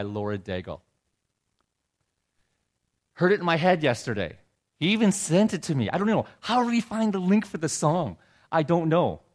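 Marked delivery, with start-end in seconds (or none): 3.1-9.2 louder, higher, amazed retelling; can't believe the son knew the song; excited. Real, not acted. Excited about son's text.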